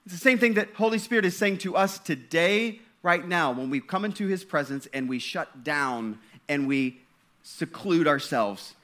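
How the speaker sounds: ordinary talk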